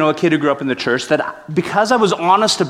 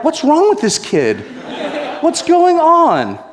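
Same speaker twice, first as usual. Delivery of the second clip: baffled disbelief; 12 semitones higher than usual. Retold or imitated. Guards baffled by the boy's question, high and incredulous. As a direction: sound completely thrown.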